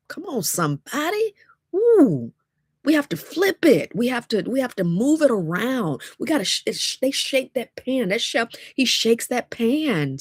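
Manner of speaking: high, excited preaching; getting excited. Real, not acted. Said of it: "ooh, I'm getting excited".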